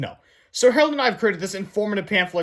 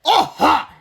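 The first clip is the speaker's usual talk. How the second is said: loud, higher gotcha shout; shouted aha; 10 semitones higher, 8 dB louder than usual. A narrator's reading. Principal catching the boys, loud shout. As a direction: catch them red-handed. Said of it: "he shouted".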